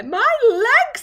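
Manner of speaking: high, loud cry of dismay; anguished cry. Acted. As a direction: cry out in alarm. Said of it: "he cried".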